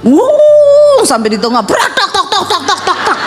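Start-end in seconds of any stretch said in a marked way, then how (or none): 0.0-1.1 high loud whoosh of a revving car; sudden high rev noise; engine surge. Retold or imitated. 1.6-3.2 high clatter noise; mock metal clanking; clattering exhaust.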